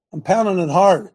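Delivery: hard hit loud at the end. Shown as a demonstration.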